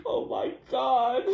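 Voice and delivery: slow, high, teary laughter; crying laughing. Real, not acted. Viewer overwhelmed by the Helen Keller mix-up, crying laughing. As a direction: wheeze it out through tears of laughter.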